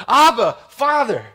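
high, loud cry to a father. Acted. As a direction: call out like a child needing Dad. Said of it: "we cry out like a little child".

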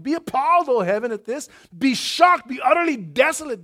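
loud, high prophetic declaration; thundering scripture. Acted. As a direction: cry it out like the prophet. Preacher reading prophet's outcry.

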